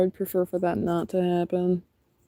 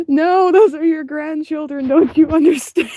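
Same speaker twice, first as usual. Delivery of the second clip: pleading; 9 semitones higher, 7 dB louder than usual. Retold or imitated. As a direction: insist on it like it's obvious family. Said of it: "And I was like".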